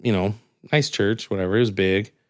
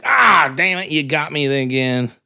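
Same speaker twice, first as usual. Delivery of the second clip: hammed-up sore loser; a touch of exasperated agent; mock frustration; 5 semitones higher than usual. Agent loses again.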